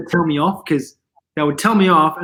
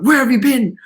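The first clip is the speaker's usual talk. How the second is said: higher-pitched imitation of mum demanding an answer; retold questioning mum; 7 semitones higher, 4 dB louder than usual. Mum questions her returning child, imitated higher-pitched questioning. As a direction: imitate a mother's pointed question. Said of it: "my mom was like".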